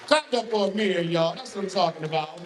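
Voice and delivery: high hype-man call to the crowd. Real, not acted. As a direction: get the room clapping. Host hypes crowd for poet.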